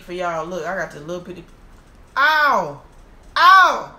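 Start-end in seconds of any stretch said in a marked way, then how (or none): 2.2-3.9 loud, high "ow" yelps; yelped outcry. Real, not acted. Eater fumbling the crab meat, yelping. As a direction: cry out sharply as it goes wrong. Mishap while cracking the crab leg.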